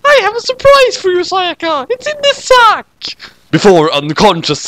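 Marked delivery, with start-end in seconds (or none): 0.1-2.9 put-on much higher, sweet sinister girl; sing-song teasing surprise. Madoka teasing Sayaka about the sack, high girl voice.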